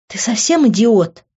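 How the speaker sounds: furious disbelief; angry. A narrator's reading. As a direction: scold him through tight lips. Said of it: "сжала от злости губы".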